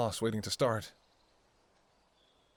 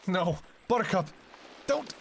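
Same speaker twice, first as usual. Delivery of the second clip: anguished scream; 13 semitones higher than usual. Acted. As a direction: scream after him as he leaves. Fiancé walks away for good.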